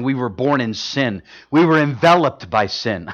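usual speaking voice

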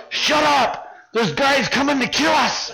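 high, urgent strained warning; urgent silencing. Retold or imitated. Guys tackling the man who woke up, urgent. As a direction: shut him up in an acted panic.

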